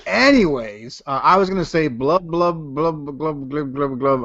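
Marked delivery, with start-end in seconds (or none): 2.3-4.2 spoken bubbling blubs; underwater talk. Acted. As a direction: imitate talking underwater, trailing off softer. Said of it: "I'm underwater and I'm talking".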